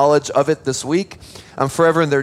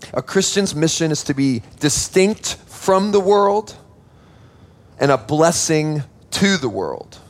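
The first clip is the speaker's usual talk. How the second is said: preacher stating the key point, deliberately; emphatic main point. Shown as a demonstration. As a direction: lay down the main point with weight. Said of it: "here's the main point this morning".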